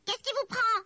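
loud, high-pitched angry elf; outraged little elf squawk; furious voice. A narrator's reading. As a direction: snap back in a high, indignant voice. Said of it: "répondit une voix furibonde".

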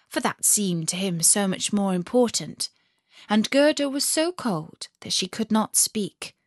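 ordinary delivery, nothing marked

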